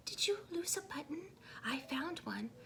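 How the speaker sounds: fast, tiny whisper; whispering sparrow. A narrator's reading. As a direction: whisper it like a shy little bird. Sparrow's small voice.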